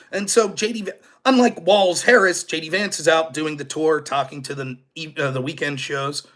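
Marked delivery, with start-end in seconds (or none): none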